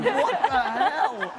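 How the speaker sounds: high, drawn-out mock outrage; acted disbelief. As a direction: stretch it out, appalled at the drunk roommate. Acted shocked reply to drunk roommate.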